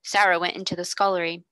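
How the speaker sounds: usual speaking voice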